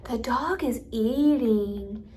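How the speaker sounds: very slow "the dog is eating", words stretched; exaggerated, drawn-out melody with a lift on DOG; varied pitch. Shown as a demonstration. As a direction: swoop on DOG and draw out EATING. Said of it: "Or I can sound like".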